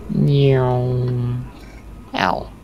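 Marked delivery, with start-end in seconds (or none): 0.1-1.4 cat meow. Pretend. Playful cat meow in game chat.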